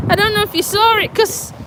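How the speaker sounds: high and fast, worked up; rushing it out; excited. Real, not acted. After near fall.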